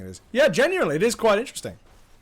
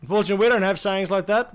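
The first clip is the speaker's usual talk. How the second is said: usual speaking voice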